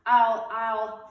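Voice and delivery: loud, stern big-sister order. A narrator's reading. Beezus ordering Ramona out to the sand pile, stern. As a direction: command her out, then stall for a threat. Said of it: "Beezus ordered".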